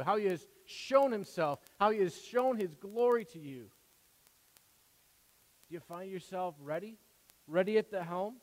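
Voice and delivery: loud, high preaching at full force. Acted. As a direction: preach it out to the congregation.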